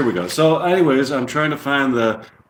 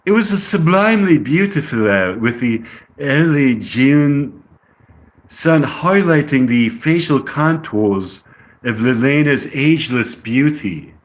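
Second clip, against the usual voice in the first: English accent; 2 semitones higher than usual. Shown as a demonstration. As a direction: narrate in an amateur English accent. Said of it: "try to talk with an English accent".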